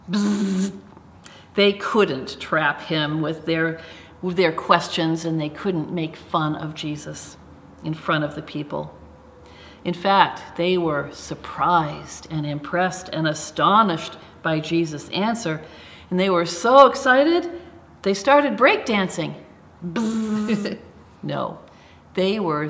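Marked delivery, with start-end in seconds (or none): 0.1-0.7 buzzing buzzer noise; wrong answer buzzer. Pretend. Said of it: "make a buzzer sound like this". 16.1-19.3 excited. Acted. Deliberately wrong silly story line.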